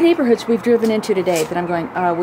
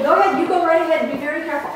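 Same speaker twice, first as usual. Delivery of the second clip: mock warning; 7 semitones higher, 3 dB louder, faster than usual. Teasing warning about the stairs.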